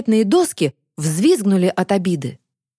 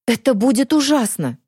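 choked, strained voice; 6 semitones higher than usual. A narrator's reading. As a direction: squeeze the words out tightly. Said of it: "произнесла Дара сдавленным голосом".

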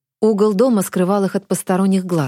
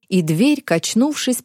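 ordinary delivery, nothing marked

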